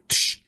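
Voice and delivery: imitated switchblade flicking open; quick hissed knife sound effect; switchblade click. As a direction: make a sharp "tsh" of a knife springing open. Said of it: "he goes".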